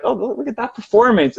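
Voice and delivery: high, delighted pointing-out; mock delighted watcher. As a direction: point at the show, charmed. Voicing the detached watcher.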